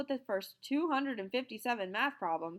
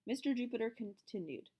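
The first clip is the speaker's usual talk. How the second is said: usual speaking voice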